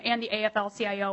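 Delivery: ordinary talk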